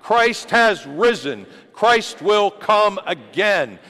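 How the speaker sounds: loud, high, slow call-and-response; leading the creed. Shown as a demonstration. Pastor leading the congregation in the creed, loud and high. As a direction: lead the congregation, slow and ringing. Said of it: "Say it with me".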